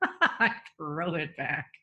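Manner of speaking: chuckling through the words; laughing. Real, not acted. Mother laughing over her son's comeback, chuckling. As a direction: laugh it out as you tell it.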